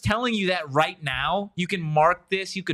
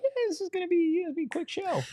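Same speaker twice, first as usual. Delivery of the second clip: high, softer self-imitation; mimicking a breezy promise, high and quieter; mock earlier promise; 13 semitones higher, 7 dB quieter, much faster than usual. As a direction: quote yourselves in a lightweight, pitched-up voice. Quoting their own promise at start.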